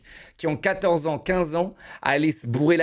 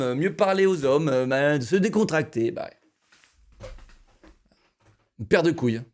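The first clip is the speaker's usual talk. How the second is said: ordinary talk, nothing marked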